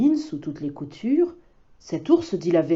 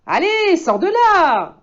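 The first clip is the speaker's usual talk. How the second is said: put-on much higher, louder child's voice; insisting boy; 10 semitones higher, 5 dB louder than usual. Boy insists the wolf come out, high and loud. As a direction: coax him out firmly. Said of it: "insiste l'enfant".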